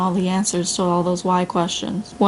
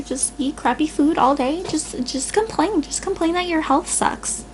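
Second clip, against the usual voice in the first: mocking whiny complainer; 7 semitones higher than usual. Speaker lampooning a self-pitying complainer, high mock whine. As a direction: sneer in a high pitiful voice.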